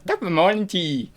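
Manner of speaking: high, quick Irish leprechaun greeting. Shown as a demonstration. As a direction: play a cartoon Irish leprechaun. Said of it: "add the leprechaun".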